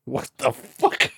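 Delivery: slow baffled what the fuck; drawn-out disbelief. Real, not acted. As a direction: drag it out in disbelief. Baffled at "non-protein or fish.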